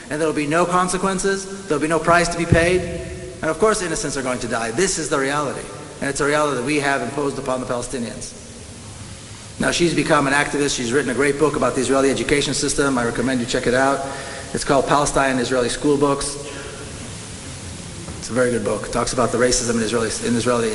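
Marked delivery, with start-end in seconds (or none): none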